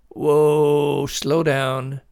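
loud, drawn-out whoa; halting. A narrator's reading. Father slowing his panicked son, halting. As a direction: rein someone in firmly.

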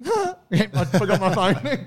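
high, slow, laughing sheepish voice; hammed-up embarrassed apology; sheepish bro. Bro sheepishly returning for phone, laughing high. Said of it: "was like".

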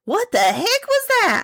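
high, sharp Southern exclamation; Appalachian granny cry; Southern woman. Acted. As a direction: yelp it like a startled mountain woman. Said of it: "she said".

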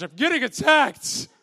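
loud mock whine; hammed-up wail. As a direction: cry out like a dramatic couch potato. Mock couch complaint.

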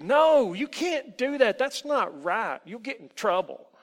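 very loud, high outburst; frightened protest. Acted. Boy refuses to be the elf's slave, frightened. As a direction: cry out in protest, then ease slightly. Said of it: "took a step back and breathed".

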